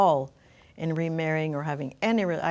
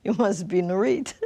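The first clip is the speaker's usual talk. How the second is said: imitating a thick Hungarian accent, louder; a light Hungarian accent for the man introducing himself; 5 dB louder than usual. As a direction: put on his Hungarian accent for the greeting. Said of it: "with a thick accent".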